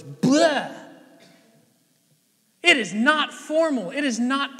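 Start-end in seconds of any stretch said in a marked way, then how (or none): 0.0-0.7 heart spilling out as a loud "bleh"; imitated messy outburst, high and loud; loud mock blurt. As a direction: blurt out a noise of falling apart. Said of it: "your heart just goes".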